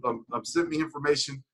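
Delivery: normal talking voice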